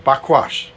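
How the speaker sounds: Bakwash said as bah-KWAHSH; app's pronunciation. Retold or imitated. Preacher repeats what an online app says, careful pronunciation. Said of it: "that's what the guy's saying".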